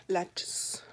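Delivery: breathy aside echo; whispered. Real, not acted. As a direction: whisper the word to yourself.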